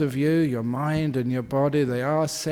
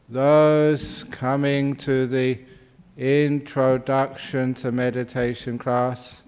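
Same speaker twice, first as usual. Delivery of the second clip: slow, drawn-out announcement to latecomers; measured, deliberate public announcement; slower than usual. Real, not acted. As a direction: spell it out slowly for people coming in. Announcing to people still coming in.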